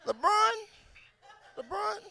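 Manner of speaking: high, soft rising repeats; fading echo. Acted. Comic's question bounces back, fading echo. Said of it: "First pussy echo".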